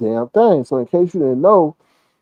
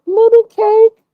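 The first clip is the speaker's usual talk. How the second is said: much higher, louder cooing woman's voice; sweet-talking woman; 5 dB louder, slower than usual. Retold or imitated. Imitating the woman begging Lemon for Cash App, high cooing. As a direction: coo it like she wants money. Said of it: "what did she call him".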